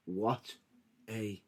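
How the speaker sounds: very quiet, low, word-by-word tease; hushed, low teaser with long gaps; teasing. Real, not acted. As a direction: drop it low and soft, pausing between each word. Teasing co-host about unseen match.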